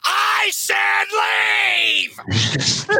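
shrieking order to leave; outside voice yell. Retold or imitated. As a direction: scream it at people who ignore you. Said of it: "talks in his outside voice and goes".

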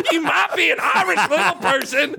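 put-on high, laughing Southern accent. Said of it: "that was a Southern accent".

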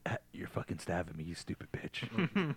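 very soft, acted muttered curse; hushed mock agony; pained mutter. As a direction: curse under your breath, as if hurt.

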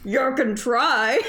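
slow troll with stuffed cheeks. Acted. Friend taking a troll part in the read-through, slow mouth-stuffed troll voice. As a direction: draw it out as a puffy-cheeked troll. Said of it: "acting like you have tennis balls in your cheeks".

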